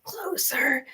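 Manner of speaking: faint breathy whisper of a dying mother; deathbed whisper. Retold or imitated. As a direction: barely breathe the word out. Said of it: "you hear me whispering".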